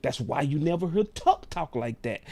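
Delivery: ordinary talk, nothing marked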